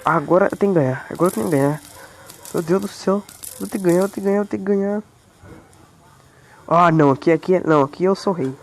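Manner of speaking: low character voice, mock determined; deep put-on boast. Gamer boasting to his squad in a low put-on voice. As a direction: growl out the boast like a villain.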